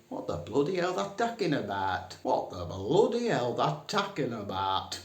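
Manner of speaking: very broad Northern "What the bloody hell"; broader Northern. Shown as a demonstration. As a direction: lay the Northern on thicker, "bloody" stretched. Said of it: "to be even more northern".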